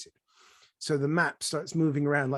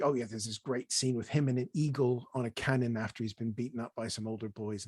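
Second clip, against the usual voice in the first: normal talking voice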